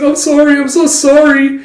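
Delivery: hammed-up panicked apology; frantic apology. Yor apologising in recap.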